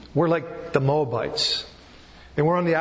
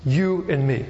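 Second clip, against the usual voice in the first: ordinary talk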